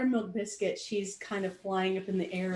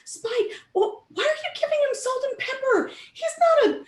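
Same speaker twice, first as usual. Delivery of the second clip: much higher scolding; much higher, indignant; indignant pony; 14 semitones higher, 6 dB louder than usual. Acted. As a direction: scold the others for seasoning him. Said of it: "she says".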